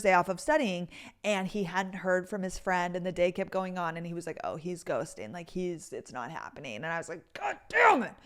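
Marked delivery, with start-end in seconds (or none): 4.4-6.8 mock glum. Imitating Carter's glum report. 7.3-8.1 high, exaggerated frustrated groan; comically frustrated outburst; mock frustrated. Retold disappointment at cancelled game.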